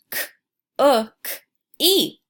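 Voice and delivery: "K-o-k-e" split into sounds; sounding out letters. Shown as a demonstration. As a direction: segment "K-o-k-e" clearly. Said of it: "I changed cook into cookie".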